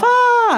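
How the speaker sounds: high, loud imitated scream; mock wail. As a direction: wail like a girl running off upset. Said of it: "She runs off".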